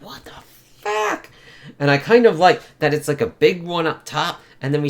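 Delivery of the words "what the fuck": sudden high yelled outburst; Rhino blasting out. Retold or imitated. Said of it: "Rhino just blasts out".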